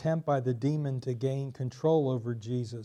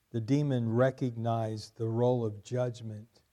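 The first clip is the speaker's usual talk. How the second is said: normal talking voice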